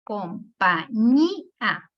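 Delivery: "Compañía" split slowly into com - pa - ÑÍ - a; syllable by syllable. Shown as a demonstration. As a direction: break "Compañía" into four separate syllables, hitting ÑÍ. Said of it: "cuál sería la división".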